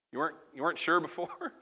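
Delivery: normal talking voice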